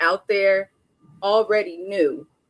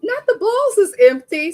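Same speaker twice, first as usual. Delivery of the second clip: higher and louder, exclaiming on "balls"; shocked outcry; 11 semitones higher, 6 dB louder than usual. Retold or imitated. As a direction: shout it out in disbelief. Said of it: "I said".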